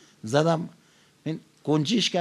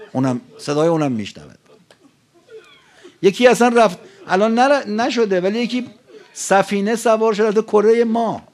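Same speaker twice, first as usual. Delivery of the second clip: loud, raised-pitch sermon delivery; impassioned preaching; 4 semitones higher, 7 dB louder than usual. Real, not acted. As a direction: preach it out forcefully to the whole hall. Preaches at full force.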